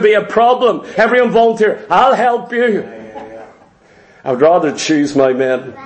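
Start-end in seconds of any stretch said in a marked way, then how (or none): 1.9-2.9 a chuckling, overeager offer of help; eager laughing volunteer. Acted. A keen volunteer laughs while offering Gideon help. 4.2-5.7 low, put-on grumble to himself; grumbled aside. Gideon grumbles low about the volunteers crowding him.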